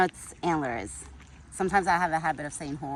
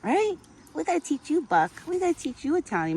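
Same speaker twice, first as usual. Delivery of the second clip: much higher sing-song pet voice; 10 semitones higher than usual. Real, not acted. Owner coaxes her deer Buck in high baby talk.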